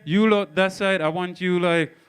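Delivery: ordinary talk, nothing marked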